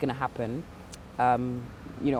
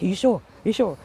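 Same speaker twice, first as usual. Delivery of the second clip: higher, louder insistent questioning; retold anxious checking; 6 semitones higher, 5 dB louder than usual. Worried patient pressing her doctors at every scan, anxious high-pitched insistence. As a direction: press for reassurance, pitch jumping up.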